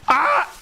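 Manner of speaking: sudden high yelp; startled yelp. Real, not acted. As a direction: yelp in surprise. Startled while hunting a deer.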